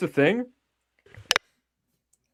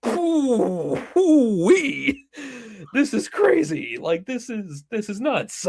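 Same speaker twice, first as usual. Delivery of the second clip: mock amazement; 9 semitones higher, much slower than usual. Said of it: "and I'm like".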